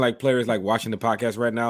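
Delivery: usual speaking voice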